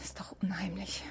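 unsettled whisper in the dead woman's flat. Real, not acted. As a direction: still whispering, spooked. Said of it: "Du brauchst nicht zu flüstern".

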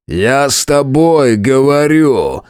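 slow, high, loud yell; shouting. A narrator's reading. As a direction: bellow each word slowly. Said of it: "кричал Кромвель".

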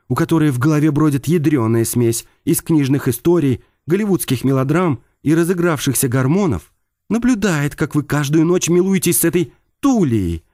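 irritated. Acted. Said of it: "нотки раздражения".

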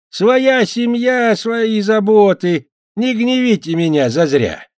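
put-on slow, high, loud drunken bellow. A narrator's reading. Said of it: "пьяным голосом".

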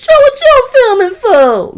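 very high, loud mocking squeal; piercing mock-indignant falsetto; mock outraged complainer. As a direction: yell it high and drawn out, hammed-up. Mimics someone angry about being filmed.

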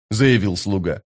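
usual speaking voice

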